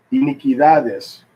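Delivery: "iniquidades" slow, punched on DA; next-to-last syllable stress. Shown as a demonstration. Teacher reading Spanish examples, "iniquidades" stressed on DA. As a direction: iniquiDAdes with DA stretched. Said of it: "on the next to the last syllable".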